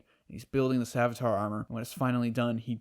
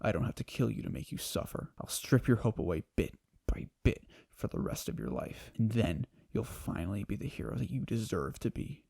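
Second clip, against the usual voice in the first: villain menace; 2 semitones lower, 5 dB quieter than usual. Retold or imitated. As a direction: promise the suffering quietly. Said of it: "And Savitar's like".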